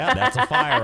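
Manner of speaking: high laughing squeal. Real, not acted. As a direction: burst out laughing at it. Laughing at another fire.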